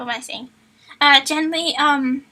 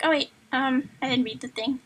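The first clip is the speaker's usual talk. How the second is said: ordinary delivery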